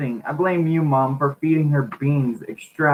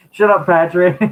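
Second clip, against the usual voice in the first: laughing retort; 5 semitones higher, 5 dB louder than usual. Real, not acted. Reader laughing at a heckler.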